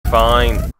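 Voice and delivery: ordinary talk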